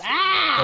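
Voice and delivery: high loud mock shriek; sister's annoyed shriek. Imitating the sister shrieking at the Boston accent, high and loud. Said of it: "she starts going".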